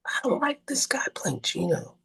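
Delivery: high, hushed imitation of a director; doubting director. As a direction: voice a director's misgivings, soft and high.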